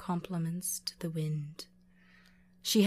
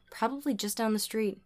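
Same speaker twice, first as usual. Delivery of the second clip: usual speaking voice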